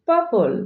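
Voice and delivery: "পার্পল" said pa-pol, r silent; correct pronunciation. Shown as a demonstration. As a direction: drop the r in পার্পল. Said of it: "but correct pronunciation হবে". ("পার্পল" র ছাড়া শুদ্ধ উচ্চারণ)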